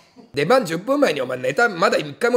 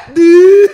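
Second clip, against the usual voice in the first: mock weird laugh; 9 semitones higher, 12 dB louder than usual. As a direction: belt out a silly "doo" laugh. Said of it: "変な笑い方すんな".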